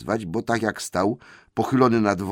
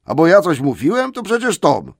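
much higher, louder denial; indignant troll; 10 semitones higher, 8 dB louder than usual. Acted. William loudly denies speaking, blaming Tom. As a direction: deny it hotly, loudly.

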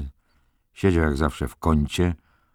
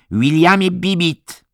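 high, loud, drawn-out icy rebuke; stern, icy; 8 dB louder than usual. A narrator's reading. Head nurse catching Billy with the girl, stern and icy. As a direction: call his full name with cold severity. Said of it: "tak surowo i lodowato".

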